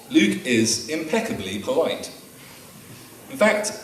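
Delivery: ordinary delivery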